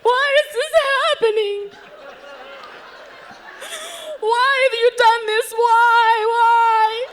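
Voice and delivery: high, loud sobbing cries to God; a grieving stranger howling at the funeral mic; anguished wailing. Retold or imitated. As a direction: wail it out, laughing through tears. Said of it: "saying into a microphone".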